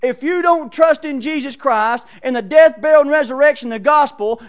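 shouted warning. Pretend. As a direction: bellow it at them like a final warning. Preacher voicing warning to visitors.

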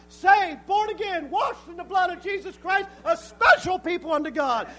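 loud revival-preacher cry, building to a shout; building pulpit fervor; impassioned preaching. Real, not acted. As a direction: drive the key words home to the congregation. Preaches at full force.

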